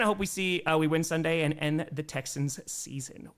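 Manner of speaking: ordinary talk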